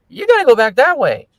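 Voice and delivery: calling out. Retold or imitated. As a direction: point him back the other way. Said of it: "And he's like".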